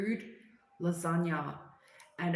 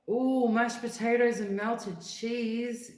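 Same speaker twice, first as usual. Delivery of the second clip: craving delight; 5 semitones higher, 5 dB louder, slower than usual. Real, not acted. Savouring food idea on stream.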